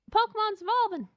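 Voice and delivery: high-pitched cartoon call; mock announcement. Alien starts to transform, high mock announcement. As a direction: pipe up in a put-on game voice.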